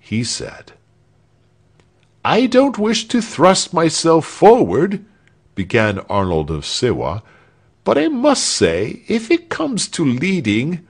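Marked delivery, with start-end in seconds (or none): 2.2-5.1 high-pitched, mock-modest voice; self-promoting character. A narrator's reading. Arnold offers himself as leader. 7.8-10.9 high-pitched, mock-modest voice; self-promoting character. A narrator's reading. Arnold offers himself as leader.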